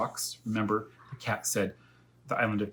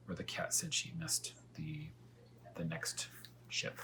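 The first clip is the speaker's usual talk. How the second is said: unvoiced hushed aside; whisper; 8 semitones higher, 11 dB quieter than usual. Real, not acted. Reader to child, whispered aside. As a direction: drop to a whisper mid-thought. Reading aside softly to listener.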